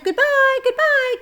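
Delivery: very high tiny spider cries; cries of goodbye. Acted. Spiders calling goodbye to Wilbur, very high. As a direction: squeak it as distant tiny voices. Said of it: "Cries of".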